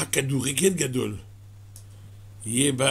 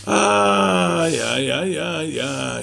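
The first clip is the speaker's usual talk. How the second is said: drawn-out sighing lament; slower than usual. Real, not acted. Rabbi laments worldly chasing.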